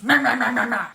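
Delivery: childish mocking meh-meh. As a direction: parrot her like a sulky kid. Cashier mocking departed customer.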